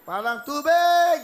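drawn-out, high, loud holler. Real, not acted. Host joking to the audience, hollering. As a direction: stretch it out into a big shout.

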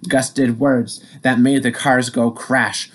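plain reading voice

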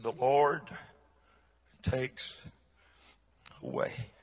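soft, broken by long pauses; retold struggling to speak. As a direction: imitate a grieving man forcing out each word. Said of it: "he could tell he was struggling".

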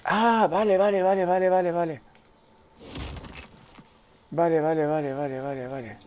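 dawning realisation. Real, not acted. Player working out the obstacle, realisation trailing off. Sudden realisation, repeated word fading.

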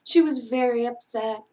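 usual speaking voice

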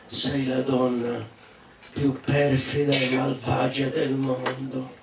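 lento e un po' cupo; accusa solenne. Acted. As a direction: scandisci piano l'accusa alla moglie. Marito accusa la moglie, lento. (accusa lenta e sommessa)

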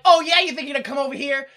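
loud, high mock scolding; indignant Serling. Serling condemning a Nazi in the Twilight Zone, indignant. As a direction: tell him off, hitting the sentence hard.

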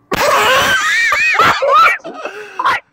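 high, helpless laughing fit; shrieking laughter. Real, not acted. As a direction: burst out laughing, high and uncontrolled. Cracking up at a noise.

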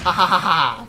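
mocking laugh.

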